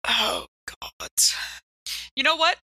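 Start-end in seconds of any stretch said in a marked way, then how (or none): none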